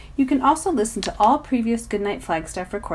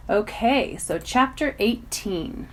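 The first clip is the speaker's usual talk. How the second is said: plain reading voice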